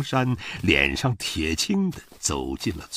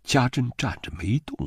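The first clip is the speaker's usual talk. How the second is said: plain reading voice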